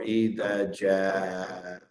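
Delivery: "ja" held long and slow; extended vowel. Shown as a demonstration. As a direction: stretch "ja" to a count of four. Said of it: "count of four".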